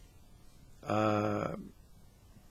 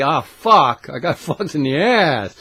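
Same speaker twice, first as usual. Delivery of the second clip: mock complaint; 2 semitones higher, 7 dB louder, slower than usual. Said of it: "and then say".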